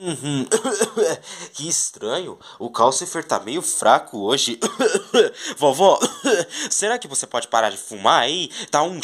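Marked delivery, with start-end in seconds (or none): 0.5-1.5 cough. Pretend. Grandmother smoking. 5.6-6.7 coughing. Pretend. Smoke in the house.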